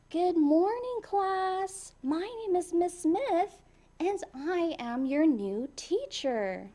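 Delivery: a lightly voiced teacher welcoming her class; cheerful new teacher.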